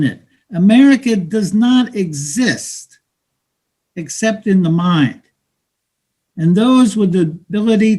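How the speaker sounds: street hustler taunting a newcomer; bitter, mocking street talk to a dreamer; cynical hustler. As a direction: talk down to him like a weary hustler.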